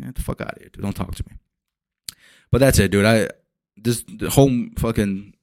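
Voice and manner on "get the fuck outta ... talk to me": whispered mock threat; mock dismissal. Telling anyone who says it wrong to leave, whispered. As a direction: hiss it as a joke brush-off. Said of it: "mock dismissal".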